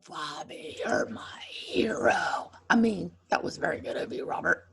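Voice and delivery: ordinary delivery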